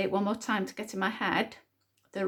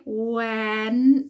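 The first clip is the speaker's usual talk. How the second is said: went stretched out sound by sound, louder; said slowly; 8 dB louder than usual. Shown as a demonstration. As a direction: draw out every sound of went. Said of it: "Let's say it slowly".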